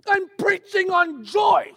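slow, high, ironic outcry; mock exasperated outburst. Preacher after chaotic morning, exasperated irony. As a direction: stretch it out in comic despair.

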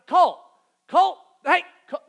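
loud, high repeated calls of the name; sharp calling. Retold or imitated. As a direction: yell the name to get his attention. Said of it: "I hear her say again".

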